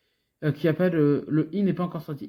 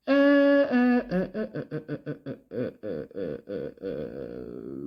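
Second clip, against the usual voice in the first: sinking euh; 12 semitones lower, slower than usual. Real, not acted. Gamer stalling on a letter, euh sinking low and fading.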